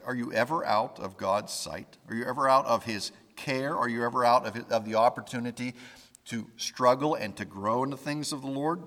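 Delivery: ordinary talk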